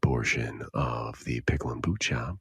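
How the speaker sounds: hushed sleepy radio host; soothing whisper. Pretend. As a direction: whisper them gently to sleep. Hosts mock sleep show.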